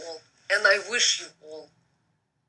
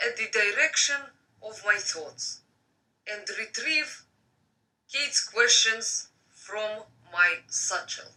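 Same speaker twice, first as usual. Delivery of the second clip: reading a novel aloud; story narration; 3 semitones higher, 4 dB louder than usual. Acted.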